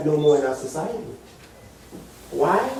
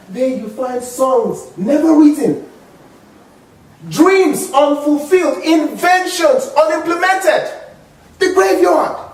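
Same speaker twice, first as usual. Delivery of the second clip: building emphasis; 9 semitones higher, 4 dB louder than usual. Retold or imitated. Building quote toward climax.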